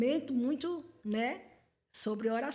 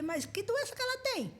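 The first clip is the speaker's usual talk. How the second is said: surprised question; 12 semitones higher than usual. Retold or imitated. Asking a church sister about illness.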